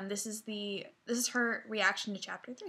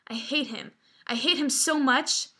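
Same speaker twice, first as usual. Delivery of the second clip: furious; 3 semitones higher, 4 dB louder than usual. Acted. Sister venting about the brother who ruined her night, furious. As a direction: build the resentment, leaning hard on the last words. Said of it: "I stood at my stand furious".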